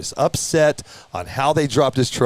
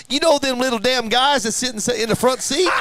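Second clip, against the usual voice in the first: very high mimicked accent, comic storytelling; Keith West's accent; 11 semitones higher than usual. Witness describing the toy drivers, in Keith's accent. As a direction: imitate his accent in a high voice. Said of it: "he's got that accent".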